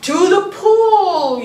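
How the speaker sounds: high, loud, slow shout; yelling. Acted. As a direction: call it out across the yard. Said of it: "yelled the fireman".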